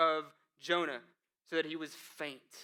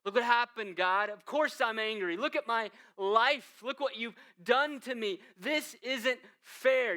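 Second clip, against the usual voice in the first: higher, louder, slow wounded protest; aggrieved complaint; 6 semitones higher, 6 dB louder than usual. Acted. Jonah complaining to God about his ruined life, aggrieved. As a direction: plead your case, hurt and indignant.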